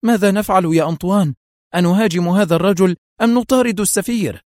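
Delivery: high, frightened appeal; panicked questioning. A narrator's reading. Mafia boss asking the pilot what to do, panicked. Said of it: "وهو يقول بذعر".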